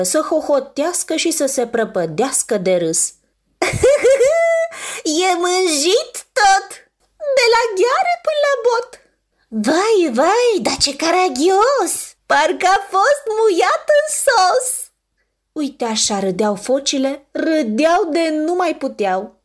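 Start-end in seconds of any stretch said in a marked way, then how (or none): 3.6-5.2 high giggling laugh; high, falling giggle; giggling seal laugh. Acted. Seals giggle at the bear. 5.3-8.9 high, teasing seal voice; high, sing-song taunt; mocking seal voice. Seal teases the dirty bear. 9.5-14.9 high, slow, teasing seal voice; high, drawn-out taunt; mocking seal voice. Another seal mocks the bear.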